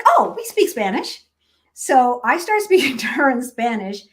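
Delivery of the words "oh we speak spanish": high, loud mock exclamation; delighted surprise. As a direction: imitate her pleased discovery, pitched well up. Retold delighted discovery of shared language.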